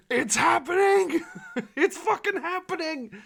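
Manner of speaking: high-pitched, laughing squeal; real excitement. Real, not acted. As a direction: squeal it in giddy triumph, laughing. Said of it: "I'm so fucking excited".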